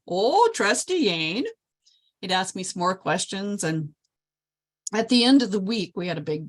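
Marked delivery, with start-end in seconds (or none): 0.1-1.4 put-on a slow, higher greeting in a Polish accent; lightly voiced Polish guest, drawn out. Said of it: "talking like this".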